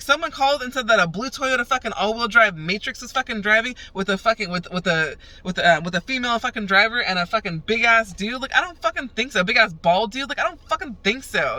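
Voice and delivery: high, heated, incredulous retort; indignant disbelief. Retold or imitated. Disputing police description, retold.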